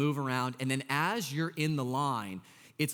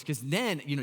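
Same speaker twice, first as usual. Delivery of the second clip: ordinary talk, nothing marked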